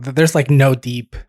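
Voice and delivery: ordinary talk, nothing marked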